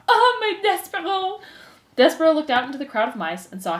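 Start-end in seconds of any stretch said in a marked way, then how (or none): 0.1-1.4 high, loud, slow melodramatic wail; calling out. A narrator's reading. Antoinette calling to her condemned son, wailing. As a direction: draw it out as a high, anguished call. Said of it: "Antoinette called out".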